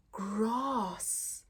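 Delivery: ordinary delivery, nothing marked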